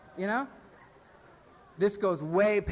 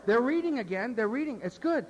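excited gushing; 12 semitones higher, 5 dB louder than usual. Retold or imitated. Newcomer telling a friend about a speaker, gushing. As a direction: rave about the speaker you just heard.

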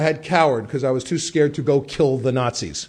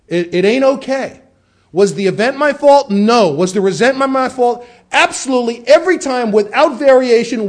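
emphatic; 6 semitones higher, 5 dB louder than usual. Real, not acted. Preaching-style emphatic build.

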